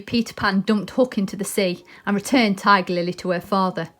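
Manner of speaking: plain reading voice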